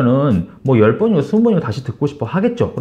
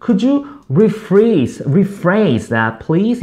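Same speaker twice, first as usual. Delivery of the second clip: slow model phrase; 4 semitones higher, much slower than usual. Shown as a demonstration. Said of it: "혹은".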